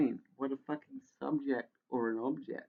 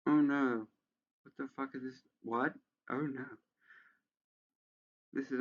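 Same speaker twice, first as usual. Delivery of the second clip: ordinary talk, nothing marked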